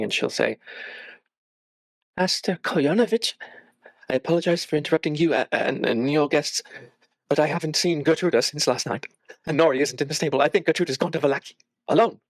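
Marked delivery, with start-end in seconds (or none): 2.2-12.2 put-on high, fretful young woman, interrupting; a distressed girl pleading, higher; Mary's voice. Frightened Mary interrupting Master Kolyanovich and his guests. Tearful Mary asks for help.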